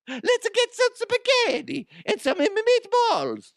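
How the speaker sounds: very high, hammed-up cartoon Italian; Super Mario. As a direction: falsetto mock Italian accent. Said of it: "it's fucking Mario from Mario Brothers".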